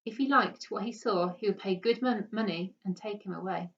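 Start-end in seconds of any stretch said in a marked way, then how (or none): none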